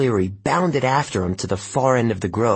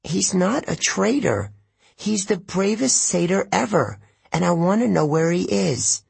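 put-on higher, insistent girl's voice, defending him; stubborn tree nymph; 9 semitones higher than usual. A narrator's reading. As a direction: nymphe têtue qui défend son ami. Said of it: "Juniper stamped her foot".

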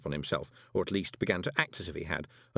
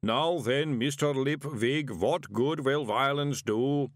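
grumbling golem; 4 semitones higher, slower than usual. A narrator's reading. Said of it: "Mister Pump grumbled".

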